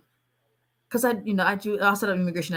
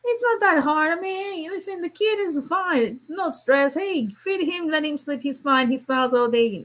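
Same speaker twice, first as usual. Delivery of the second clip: high, breezy mock voice of an overconfident parent; carefree high-pitched parody of a first-time mother; naive new mom; 9 semitones higher than usual. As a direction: play your younger self who thinks it's easy. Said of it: "and you're like".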